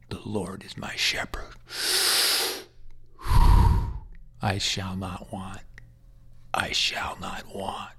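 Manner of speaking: breathless whisper, slow and faint; whispered echo. Retold or imitated. Dying patient echoing the pastor's psalm, in a faint whisper. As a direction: barely breathe the words back, too weak to speak.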